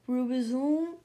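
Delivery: grand mysterious riddler; Sphinx voice. A narrator's reading.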